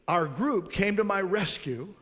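ordinary talk, nothing marked

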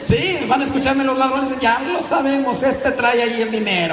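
high laughing mock alarm. Real, not acted. Priest joking to the congregation about thieves, laughing. Jokes thieves will hear.

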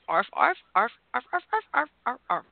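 yappy little puppy yaps; puppy barking. Pretend. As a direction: bark like a small puppy. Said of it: "I can speak puppy".

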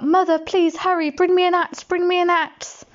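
calling out to mother; Jack calling. Acted. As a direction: call for help from the beanstalk. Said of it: "he called out".

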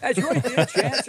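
put-on high, slow old cowboy drawl with a Boston lilt; Walter Brennan cowboy. Mocking a Boston cowboy to friends, high slow old-timer voice. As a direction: mimic a creaky old Western sidekick. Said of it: "playing a cowboy with a Boston accent".

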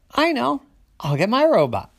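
bursting with the idea; excited idea. Acted. Little sister thinking of her robot, excited. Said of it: "Little sister said".

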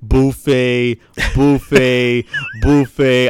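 loud, drawn-out repeated word; mock outburst. Host ribbing his co-host over a running joke, loud mock outburst. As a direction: belt each word out slowly in mock outrage. Replays last episode's buffet outburst.

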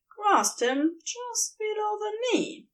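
usual speaking voice

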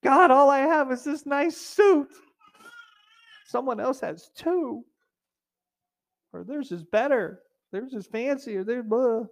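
hammed-up jealous whine; mock complainer. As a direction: whine at God about the suit. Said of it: "Sounds like us sometimes".

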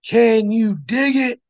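high, slow wrestler-style callout; wrestling catchphrase. Acted. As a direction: belt it like a wrestling promo.

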